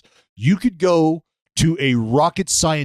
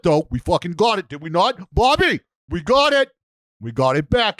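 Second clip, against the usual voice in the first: Boston accent impression; 5 semitones higher than usual. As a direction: ham up a proud Boston Red Sox fan with a heavy Boston accent. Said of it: "a better Boston accent impression".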